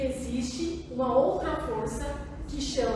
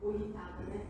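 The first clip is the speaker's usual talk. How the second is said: soft, low, tender check-in; caring; 6 semitones lower, 10 dB quieter, faster than usual. Shown as a demonstration. Helper addressing an irritated person, soft and caring. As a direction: offer help quietly, in a lowered voice. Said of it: "Aí você fala com carinho".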